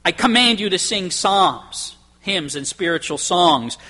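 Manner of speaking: slow, stressed command on "command"; firm command. Shown as a demonstration. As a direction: spell out the order slowly. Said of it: "he's basically saying".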